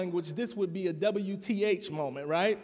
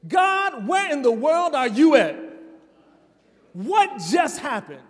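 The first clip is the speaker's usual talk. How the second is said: much higher and louder, desperate pleading; anguished cry to God; 6 semitones higher, 7 dB louder than usual. Shown as a demonstration. Pastor demonstrating a psalm of disorientation, anguished cry. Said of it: "Psalms of disorientation sound like this".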